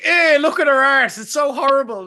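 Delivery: loud, high laddish sneer; hammed-up laddish jeering; mock tabloid jeer. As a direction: jeer like a nineties tabloid lad. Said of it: "it's like".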